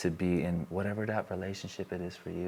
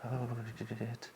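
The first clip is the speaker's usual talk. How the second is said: nervous aside; 3 semitones higher, 10 dB quieter than usual. Pretend. Fake confidence collapsing.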